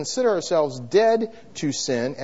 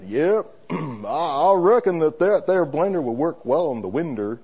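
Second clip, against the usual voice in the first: put-on backwoods Southern accent. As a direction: play a backwoods Southern good old boy. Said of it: "some backwoods sort of place in the South".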